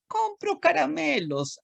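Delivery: little ant voice. Acted. Ant voiced deciding purchases.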